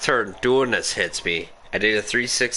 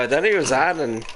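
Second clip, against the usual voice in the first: usual speaking voice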